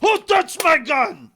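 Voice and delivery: high, loud, slow Heavy yell. Retold or imitated. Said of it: "That's Sasha".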